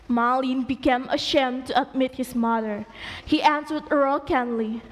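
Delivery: ordinary delivery, nothing marked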